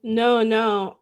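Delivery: usual speaking voice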